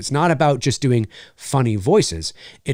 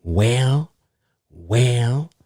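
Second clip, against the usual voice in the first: failed Reagan attempt; 2 semitones lower than usual. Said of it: "Okay, do it".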